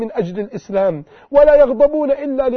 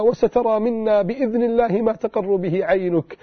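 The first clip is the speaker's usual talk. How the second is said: usual speaking voice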